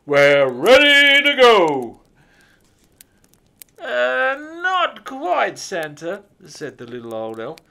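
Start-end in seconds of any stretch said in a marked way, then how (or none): 0.0-2.0 loud, higher, slow Santa voice; rally the elves cheerfully; jolly Santa. A narrator's reading. Santa telling the elves to load up, jolly and loud. 3.8-6.2 high, loud hesitant elf voice; little old elf. A narrator's reading. Elf hedging his answer to Santa, high and hesitant.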